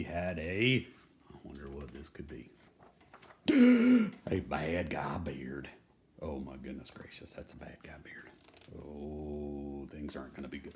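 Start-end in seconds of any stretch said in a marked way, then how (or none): 3.1-4.2 loud, high theatrical gasp; big gasp. Acted. Reader gasps at the bad guy beard, loud and high. Dramatic page reveal. 4.3-5.7 slow, drawn-out "a bad guy beard"; slow reveal. Reading to kids, slowly revealing the bad guy beard. 6.2-10.7 hushed, worried whisper; ominous whisper. Acted. Reader whispers to the children that trouble is coming.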